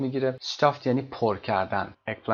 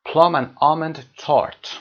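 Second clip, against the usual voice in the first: slow, louder model reading; 4 semitones higher, 7 dB louder, much slower than usual. Shown as a demonstration. Teacher models English item.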